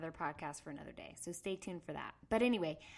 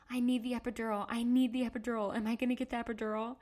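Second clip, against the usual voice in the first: higher, urgent, repeated plea; frantic pleading; 4 semitones higher, 4 dB louder, faster than usual. Retold or imitated. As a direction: beg the doctor, speeding up as you go. Frantic plea to doctor during labour.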